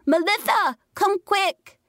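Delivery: put-on high child's voice calling out urgently; little boy bellowing. A narrator's reading. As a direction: shout for your sister like a scared six-year-old. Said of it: "He bellowed at the top of his lungs".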